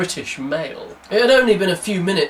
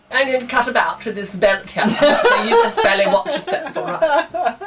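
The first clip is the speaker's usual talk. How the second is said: higher, laughing mock threat; mock menacing thug; 8 semitones higher than usual. Mocking the villain's threat, laughing mock thug. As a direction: ham up a comedy heavy.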